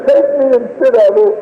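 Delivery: high, sustained grieving plea; anguished prayer. Retold or imitated. Father praying for his dying child, anguished pleading. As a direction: beg God with a breaking heart.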